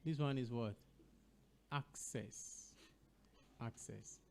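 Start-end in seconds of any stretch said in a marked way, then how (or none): 1.7-4.2 "Access" with a K, soft; correct pronunciation. Shown as a demonstration. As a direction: sound K then S in "Access". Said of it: "help us with the pronunciation".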